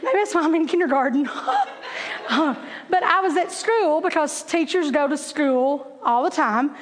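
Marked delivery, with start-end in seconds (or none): 1.3-2.2 high breathy giggle; a quick airy high laugh. Real, not acted. Laughing at her kindergarten joke, airy high giggle.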